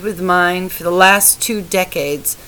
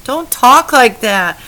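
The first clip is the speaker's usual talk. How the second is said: higher and louder, cutting him off; urgent protest; 6 semitones higher, 8 dB louder than usual. A narrator's reading. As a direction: stop him saying it, urgently. Wife pleads with dying husband.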